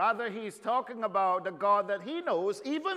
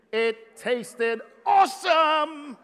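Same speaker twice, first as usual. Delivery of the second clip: slow, loud, higher "awesome" through a laugh; laughing boast; 5 semitones higher, 5 dB louder, slower than usual. Real, not acted. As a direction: call it out with a laugh, relishing it.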